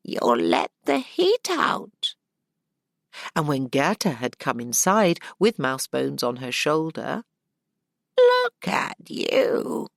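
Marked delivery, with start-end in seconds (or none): 0.0-2.1 put-on high, thin old crone voice; a cackling old innkeeper woman welcoming her in. Old woman scolds at the door. 8.2-9.9 very high, slow crone voice; old woman voice. Acted. Old woman eyes the guest.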